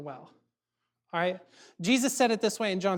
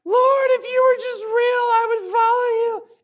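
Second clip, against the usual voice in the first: mock crying plea; 11 dB louder than usual. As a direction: cry out to the Lord like a cheesy movie hero.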